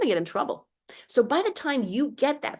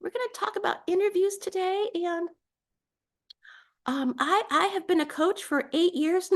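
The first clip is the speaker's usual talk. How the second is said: timid meek voice; 10 semitones higher than usual. Shown as a demonstration. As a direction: sound scared and unsure of yourself. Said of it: "Do you see how that just".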